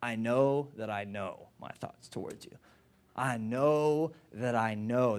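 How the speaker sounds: low, soft and slow, every know weighted; hushed emphatic. Shown as a demonstration. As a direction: let it sink in quietly. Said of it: "translate it this way".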